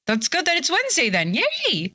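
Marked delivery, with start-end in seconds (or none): 1.3-1.9 excited yay. Real, not acted. Cheering on stream.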